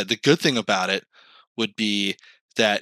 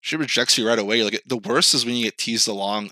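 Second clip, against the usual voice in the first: usual speaking voice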